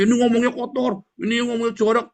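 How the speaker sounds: mengejek penuduh. Retold or imitated. Mimics his accusers' complaint.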